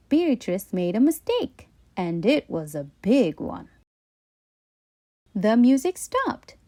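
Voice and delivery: usual speaking voice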